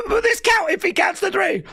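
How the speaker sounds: high shouted mock commentator; hammed-up panicked announcer yell; Michael Cole impression. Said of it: "Michael Cole going".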